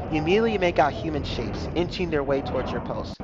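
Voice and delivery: usual speaking voice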